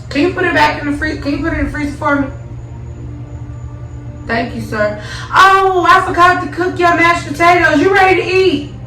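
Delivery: high, giggly tipsy voice. Real, not acted. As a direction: sweet-talk him, tipsy and giggling. Tipsy sweet talk to partner.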